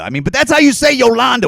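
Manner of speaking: Yolanda said high and loud, yo-LAHN-da with a broad ah; insisting Yolanda is said yo-LAHN-da; exaggerated Yolanda. Shown as a demonstration. Pronouncing his own way, Yolanda said yo-LAHN-da. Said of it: "I said Yolanda".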